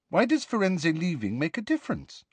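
put-on high, inquiring girl's voice; curious. A narrator's reading. Hermione asks Hagrid about Firenze, curiously. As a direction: ask with lifted, curious pitch. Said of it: "asked Hermione curiously".

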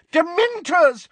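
DEMENTORS cried high and loud, falling; furious cry. A narrator's reading. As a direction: hurl out the word as an accusation. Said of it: "cried Missus Figg".

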